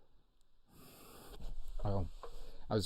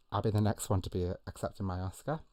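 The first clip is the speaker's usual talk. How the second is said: normal talking voice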